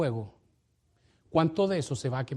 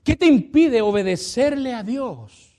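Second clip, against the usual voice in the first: louder, higher, slow challenge on impide; 7 semitones higher, 6 dB louder, slower than usual. Acted. Pastor challenging the church to obey, loud and slow. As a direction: press each word on the listener.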